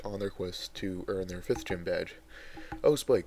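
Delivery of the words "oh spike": Oh, Spike called out a little higher and louder. A narrator's reading. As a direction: call out to Spike, lightly voiced. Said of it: "called out Twilight Sparkle".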